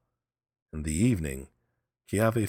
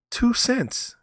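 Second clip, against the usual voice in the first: much higher, louder cry; young man; 6 dB louder than usual. Acted. Young man confessing the price, high and loud. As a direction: squeal the answer out high. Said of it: "he said".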